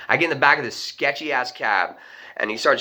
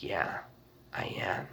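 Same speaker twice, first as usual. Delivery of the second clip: eerie stranger; 5 semitones lower, 16 dB quieter than usual. Retold or imitated. Eerie stranger answers, retold.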